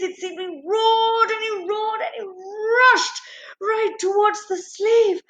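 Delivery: high-pitched, urgent narration with stretched roaring words; breathless-sounding, dramatic rush of action; frantic storytelling climax. A narrator's reading. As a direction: build the charge to a fever pitch. Narrating the lion's roaring charge.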